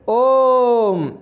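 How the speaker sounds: long high chanted ओम held for three beats; drawn-out Om. Shown as a demonstration. Teacher demonstrating pluta on ओम. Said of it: "इस प्रकार से".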